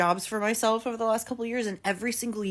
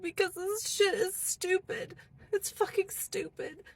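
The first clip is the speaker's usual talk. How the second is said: exasperated; 8 semitones higher, 4 dB quieter than usual. Real, not acted. Complaining about grocery prices.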